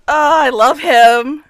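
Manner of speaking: drawn-out loud adoring sigh; slow loud gush. Real, not acted. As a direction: gush over him, slowly and loudly. Gushing over character.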